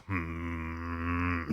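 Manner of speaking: long, very low hmm; drawn-out soft low hum; pondering hum. Acted. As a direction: hum deep and long in mock thought. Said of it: "And Snake goes".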